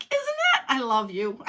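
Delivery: very high, loud gush breaking into a laugh; gushing delight. Retold or imitated. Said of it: "And I was like".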